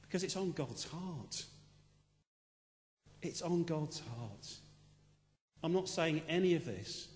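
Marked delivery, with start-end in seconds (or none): 3.2-4.6 slow, soft echo of the line; slow emphatic repeat. Acted. Pastor repeating the point to the congregation, slow and soft. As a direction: let it sink in, drawn out slowly.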